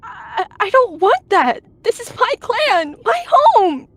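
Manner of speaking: high, loud, slow outraged wail; anguished yowl. A narrator's reading. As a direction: yowl in fury at the betrayal. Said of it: "she growled, yowling".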